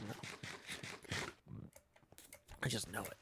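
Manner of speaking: soft whispered aside; whispering. Real, not acted. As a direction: hush it to yourself. Hushed trailing aside.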